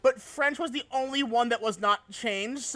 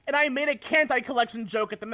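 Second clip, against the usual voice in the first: ordinary talk, nothing marked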